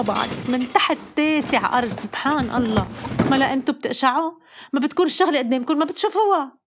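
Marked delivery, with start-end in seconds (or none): 3.3-6.6 fast and higher, rising to a shrill peak; exasperated mother. Retold or imitated. Mother scolding kids who can't find things, fast and exasperated. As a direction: rattle it off like a fed-up mom.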